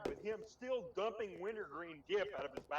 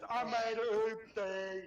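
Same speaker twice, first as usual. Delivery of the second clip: slow, louder mock announcement; goofy classmate; 5 dB louder, much slower than usual. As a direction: drag it out loud, imitating the silly kid. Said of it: "he stood up and goes".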